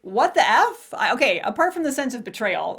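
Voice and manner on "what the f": louder, higher mock outrage; retold what-the-F shock; shocked outburst. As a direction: blurt it out stunned. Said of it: "I'm like".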